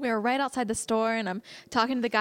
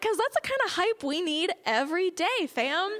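higher, thrilled hype voice; excited; 7 semitones higher than usual. Retold or imitated. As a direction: sell it with hype. Excited retold reply.

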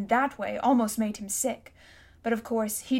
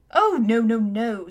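shocked; 6 dB louder, slower than usual. A narrator's reading. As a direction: deny it as if scandalised. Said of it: "said Uncle Andrew in a shocked voice".

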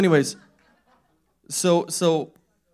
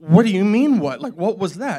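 loud, raised-pitch disbelief; incredulous wife; 5 semitones higher, 10 dB louder than usual. Retold or imitated. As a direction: imitate an exasperated wife demanding an explanation. Exasperated wife confronts husband.

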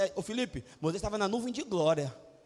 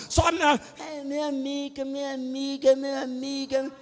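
whiny excuse; 3 semitones higher, 3 dB louder than usual. Retold or imitated. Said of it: "friend's excuse repeated".

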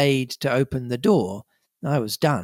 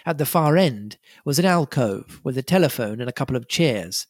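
ordinary delivery, nothing marked

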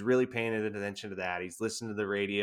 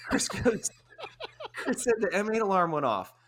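high, louder, through laughter; laughing while telling; 11 semitones higher, 4 dB louder than usual. Retold or imitated. Buddy telling the teammate through laughter. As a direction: tattle on him while cracking up.

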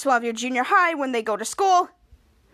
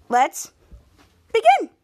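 big, loud, high call to begin; excited kickoff; 8 semitones higher, 6 dB louder than usual. Real, not acted. Opening the story for viewers, shouted high with excitement. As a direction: launch it like a showman. Kicking off the retelling.

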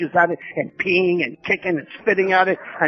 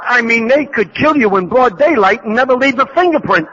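indignant rant; 5 semitones higher, 3 dB louder than usual. Retold or imitated. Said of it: "I remember saying to Jackie".

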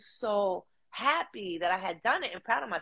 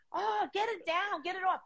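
mock panic; 12 semitones higher, 4 dB quieter than usual. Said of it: "I'm like".

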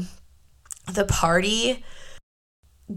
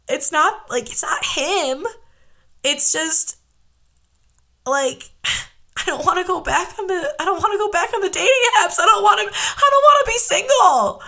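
much higher, cracking into tearful wails; crying to a friend; 10 semitones higher than usual. Retold or imitated. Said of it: "I remember crying and saying".

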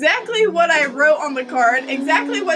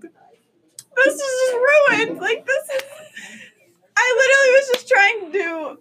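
high, wailing phone lament; hammed-up tearful wail; mock crying; 9 semitones higher than usual. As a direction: whine it as if crying to mom. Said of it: "like, crying on the phone".

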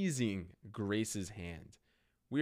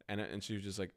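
usual speaking voice

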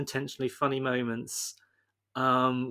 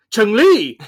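mock dramatic yell of her name, much louder; sudden shout; 7 semitones higher, 12 dB louder than usual. Guile remembering Chun-Li in his car, hammed-up shout. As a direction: blurt the name out like a sudden realization. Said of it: "and then he goes".

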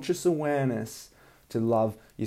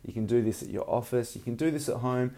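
usual speaking voice

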